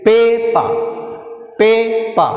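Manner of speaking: Paper with high, held PA, falling -per; first-syllable stress. Shown as a demonstration. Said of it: "first syllable".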